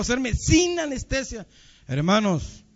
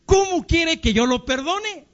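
high, slow, indignant question; incredulous protest; 8 semitones higher, slower than usual. Retold or imitated. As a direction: imitate a wife aghast at the very idea. Woman refuses to forgive husband.

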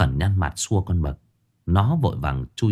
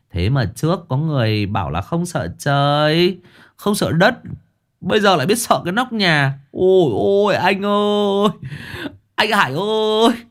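much higher, sing-song mockery; mocking cackle; 10 semitones higher than usual. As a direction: tease him in a camp whine. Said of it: "cười khành khạch".

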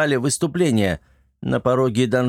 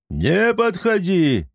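slow, drawn-out hysterical yell; panicked brute shouting back; 7 semitones higher, much slower than usual. A narrator's reading. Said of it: "истерично орал один из них". (истеричный крик)

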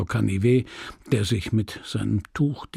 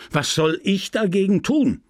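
irritated outburst; 7 semitones higher than usual. Acted. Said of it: "rief er eines Tages ungehalten".

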